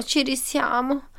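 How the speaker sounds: soft, slow resignation; sighing. A narrator's reading. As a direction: say it slowly on a sigh. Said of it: "Lupo sospirò".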